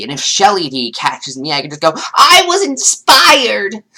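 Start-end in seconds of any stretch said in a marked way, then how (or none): 2.1-3.8 high, slow, whiny put-on voice; mock excuse voice. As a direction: say it like a smug excuse.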